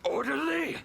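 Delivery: outraged, rising disbelief at the word; indignant echo. Acted. Villain offended at being called orderly, indignant echo. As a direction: spit the word back in offense.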